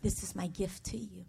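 tender divine whisper-like reassurance; soft voice of God; God's gentle voice. Retold or imitated. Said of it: "I hear God saying".

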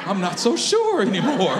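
self-mocking answer dissolving into laughs; laughing. Real, not acted. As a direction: laugh through the confession. Joking answer breaking into laughter.

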